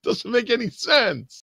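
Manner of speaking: high, baffled whine; hammed-up bewildered complaint; exasperated protest. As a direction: squeal it in mock disbelief. Player ends the mock rant.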